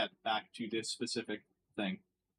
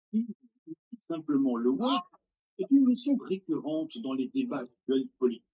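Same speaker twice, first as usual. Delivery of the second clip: loud, high, hammed-up French accent; mock French pundit voice; mock French YouTuber; 5 semitones higher, 5 dB louder than usual. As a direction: ham up an earnest French YouTuber.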